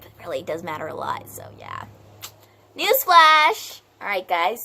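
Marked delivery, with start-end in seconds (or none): 2.8-3.8 high, loud called-out announcement; announcing. Acted. Teacher interrupting the lesson, loud high announcement. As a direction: call it out like breaking news.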